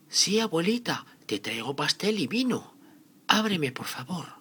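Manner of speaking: put-on high, loud childlike voice; little girl voice. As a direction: call through the door like a sweet child. Caperucita at grandma's door.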